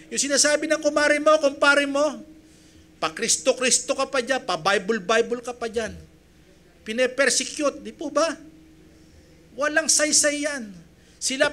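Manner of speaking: loud, high, rousing sermon voice; full-force preaching. Acted. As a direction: preach it hard to the congregation, building fervor. Pastor preaching to congregation.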